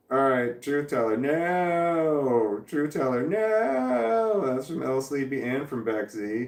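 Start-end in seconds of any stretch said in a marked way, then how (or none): 1.2-2.6 long, swelling, higher-pitched 'no'; drawn-out no. Acted. Drawn-out reply to chat message. 3.2-4.4 high, long wail of 'no'; drawn-out no. Acted. Repeats drawn-out no, higher.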